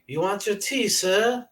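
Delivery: put-on high old lady voice asking about tea; mock elderly British lady offering tea. Imitating the ghost voice for the listeners, old lady. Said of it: "Sounds like a, a, like, an older lady".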